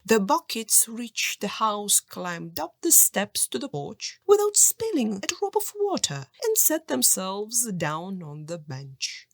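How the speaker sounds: ordinary delivery, nothing marked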